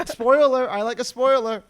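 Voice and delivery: normal talking voice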